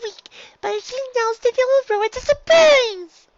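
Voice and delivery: high little filly, nervous and earnest; a small filly guarding her secret; eager filly voice. A narrator's reading. Dinky explaining her secret gift to her teacher, high and eager.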